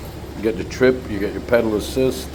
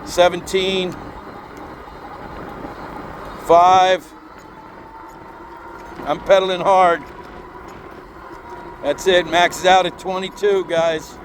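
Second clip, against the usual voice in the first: shouting over wind; 5 semitones higher than usual. Real, not acted. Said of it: "Hopefully you can hear me over this wind".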